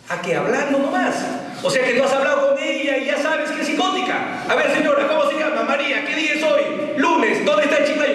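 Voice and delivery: mock the expert's method with scorn; mocking judge.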